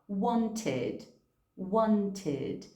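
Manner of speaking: "wanted" ends in a full id syllable; teacher models "wanted" as want-id. Shown as a demonstration. Teacher shows students the id ending. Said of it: "agora sim eu pronuncio esse id".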